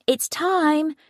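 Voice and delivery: high, eager call across the room. A narrator's reading. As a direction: call out that time is up. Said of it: "she shouted".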